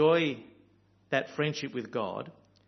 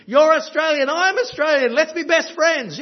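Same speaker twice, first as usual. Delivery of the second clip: much higher and louder, gushing delight; excited tourist; 13 semitones higher, 11 dB louder than usual. Retold or imitated. Meeting a young guy from Perth abroad, gushing excitement. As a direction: burst out like you've found a long-lost mate.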